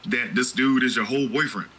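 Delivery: usual speaking voice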